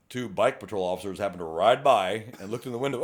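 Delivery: ordinary talk, nothing marked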